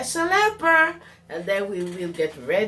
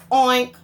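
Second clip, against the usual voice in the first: mock oink; 4 semitones higher, 7 dB louder than usual. Pigs answer.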